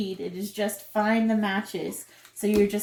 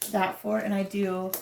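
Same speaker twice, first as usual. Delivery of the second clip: ordinary delivery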